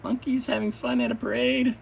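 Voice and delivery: high, breezy and unbothered; Adam's carefree voice. Acted. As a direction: shrug off the chaos. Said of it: "then he goes".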